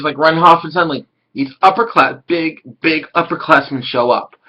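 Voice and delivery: usual speaking voice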